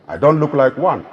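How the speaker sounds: normal talking voice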